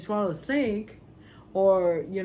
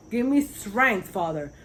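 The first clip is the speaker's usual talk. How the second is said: raised, drawn-out plea to God; loud, higher appeal in prayer; pleading prayer; 4 semitones higher, 6 dB louder, slower than usual. Acted. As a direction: call out to the Father for strength.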